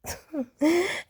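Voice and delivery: short high laugh. Real, not acted. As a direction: break into a laugh. Laughs at own impression.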